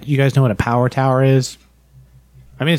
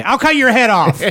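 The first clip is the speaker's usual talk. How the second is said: megalomaniac rant; 10 semitones higher than usual. Retold or imitated. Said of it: "a villain speech".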